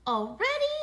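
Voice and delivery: high, loud, incredulous question; dismayed protest. A narrator's reading. Arthur to father announcing bedtime, dismayed protest. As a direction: protest with a rising whine.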